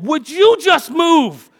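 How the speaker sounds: high, loud, fed-up outburst; exasperated shout. Retold or imitated. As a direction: blurt it out in frustration. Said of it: "I'm sitting there going".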